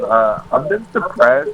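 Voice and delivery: ordinary talk, nothing marked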